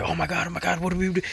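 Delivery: high, hushed mock panic. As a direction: play the person who panics. Panicking person quoted.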